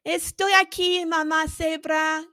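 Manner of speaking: put-on baby elephant calling to papa; high-pitched baby voice. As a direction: squeak it like a happy little baby. Baby voice as before.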